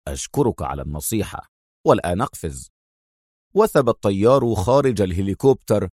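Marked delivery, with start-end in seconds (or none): 0.1-2.7 low, softer, unruffled; cool and amused, polite; quiet, low calm thanks. A narrator's reading. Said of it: "قال أدهم في هدوء".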